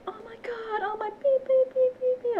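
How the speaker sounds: high-pitched mock freakout; mock screaming. Woman imitating a screaming streamer she played with, mock screaming. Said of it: "he just kept screaming".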